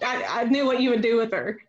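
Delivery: ordinary talk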